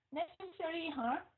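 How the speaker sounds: ordinary talk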